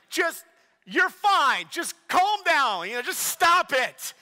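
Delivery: high, loud mock scolding; dad losing patience, hammed up; exasperated dad. Dad telling upset daughter to settle down, loud mock exasperation. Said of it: "me being the dad".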